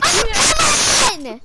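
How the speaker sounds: high, loud scream of defeat. Real, not acted. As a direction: scream it out in exasperation. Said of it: "I'm screaming".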